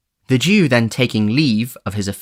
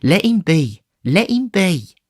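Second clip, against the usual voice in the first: higher, hurried peacemaker; calm them both down; Nancy soothing; 10 semitones higher, slower than usual. Acted. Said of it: "coming hastily forward".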